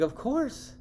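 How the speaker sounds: very high-pitched quoted reply; imitated high, ready agreement; retold eager reassurance. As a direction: jump way up in pitch, as if obvious. Retells own ready reply to mom.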